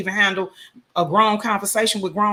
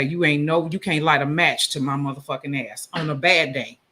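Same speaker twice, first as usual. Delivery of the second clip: ordinary talk, nothing marked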